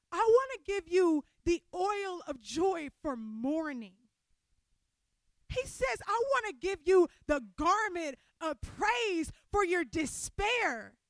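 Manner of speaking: soaring pulpit delivery; full-force preaching. Real, not acted. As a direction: proclaim each promise. Preacher quoting God's promise.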